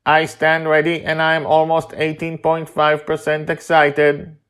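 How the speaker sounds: high, even, mechanical voice; deadpan robot. Acted. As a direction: report it like a calm machine. Robotic Mastermind answers.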